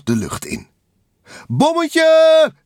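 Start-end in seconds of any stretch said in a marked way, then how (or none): 1.5-2.5 very high, loud, drawn whoop; gleeful shout. A narrator's reading. Takel flying over the city, high gleeful holler. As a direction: holler it high like a cannonball jump. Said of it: "riep Takel".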